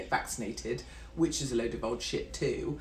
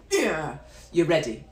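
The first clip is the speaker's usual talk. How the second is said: ordinary talk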